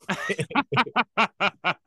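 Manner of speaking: a burst of high, loud laughter; real laughter breaking out; big laugh. Real, not acted. Laughing at his dad's line.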